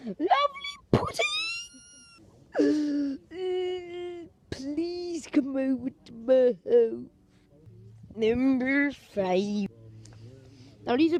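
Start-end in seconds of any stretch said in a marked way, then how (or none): 0.1-1.2 sudden very high squeak; squeaky creepy voice. Acted. Stalker tempting a child with pudding, squeaky high. 2.5-4.2 high hammed-up gasp; mock gasp. Stalker skit, hammed-up high gasp. 4.5-9.7 drawn-out, slow coaxing voice; slow creepy plea. Acted. Stalker begging the child to come over, slow.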